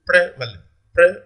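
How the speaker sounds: prevalent with PRE set apart, then PRE alone; stress on first syllable. Shown as a demonstration. Teacher shows students the stressed syllable, PRE picked out in prevalent. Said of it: "let me show you pre".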